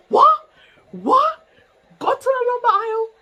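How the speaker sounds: loud, high mom outburst; retold outraged shout. Mom ordering daughter on phone, loud high outrage. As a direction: yell it as an incensed mother.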